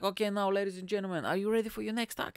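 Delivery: comedy host calling the next act up; MC announcing. Retold or imitated. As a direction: hype the crowd like a club MC, quickly. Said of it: "he was like".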